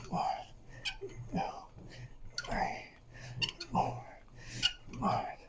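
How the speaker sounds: counting reps under breath; whispered counting. Real, not acted. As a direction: count each rep in a whisper. Counting reps while lifting.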